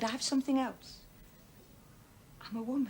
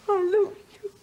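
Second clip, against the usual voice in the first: tearful plea; 8 semitones higher, 8 dB louder than usual. Acted. Wife pleading, near tears.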